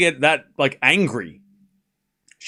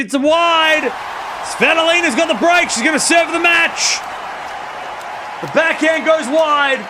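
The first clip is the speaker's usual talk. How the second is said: excited shout; 12 semitones higher, 4 dB louder than usual. Real, not acted. Break point won, shouting.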